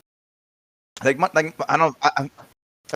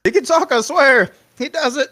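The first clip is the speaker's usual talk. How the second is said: mock frantic man; 10 semitones higher than usual. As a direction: beg them to believe it. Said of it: "the dude that was following the frog".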